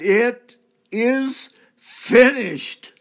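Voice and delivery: slow, high, very loud final cry. Acted. Jesus on the cross calls out, slow loud cry. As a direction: drag it out and cry it to heaven. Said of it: "he cried out in a loud voice".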